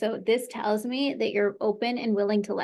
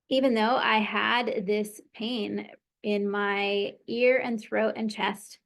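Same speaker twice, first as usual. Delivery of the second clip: guided tapping script. Acted. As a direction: lead her gently, phrase by phrase, letting each line sink in. Leads an EFT tapping script.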